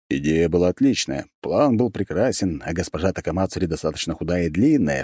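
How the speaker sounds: usual speaking voice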